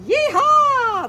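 loud, high whooping yeehaw; cowboy yeehaw. Acted. The cow cheers in the story, loud high yeehaw. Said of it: "the cow says".

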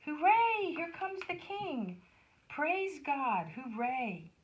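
high cheer, easing down by the end; joyful shouting. A narrator's reading. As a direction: cheer like a crowd greeting its king. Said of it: "everyone was shouting and waving with joy".

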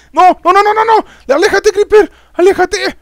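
very high, loud panicked shrieking; panicked screaming. Real, not acted. Player telling a Creeper to get away, panicked screaming. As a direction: shriek at it to back off.

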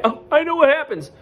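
mock smug, high and loud; know-it-all kid. Kid spoiling the surprise for everyone, mock know-it-all. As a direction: imitate a show-off spoiler in a high voice. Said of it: "you think you're being cool".

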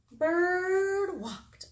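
high, stretched-out storytelling; dramatic narration. A narrator's reading. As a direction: draw out the bird's name. Dramatic narration children act out.

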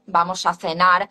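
"vamos a cenar" with a lisped th in cenar; Castilian: "cenar" as thenar, rising end; Spain Spanish accent. Shown as a demonstration. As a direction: acento de España: "cenar" con zeta. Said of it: "standard Spanish pronunciation".

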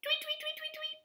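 very high little chirps; bird tweeting. A narrator's reading. Little pig up in a tree, high bird chirps. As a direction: tweet rapidly like a tiny bird. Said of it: "the little pig, saying".

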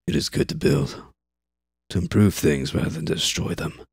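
gruff worker. Acted.

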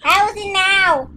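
squeaky high voice; helium voice. Real, not acted. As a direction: chipmunk voice with laugh.

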